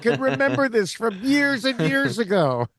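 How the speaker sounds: high-pitched, delighted outburst; thrilled vindication. Retold or imitated. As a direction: exclaim it like you've found an ally at last. Acting out own delighted reaction.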